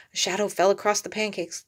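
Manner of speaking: usual speaking voice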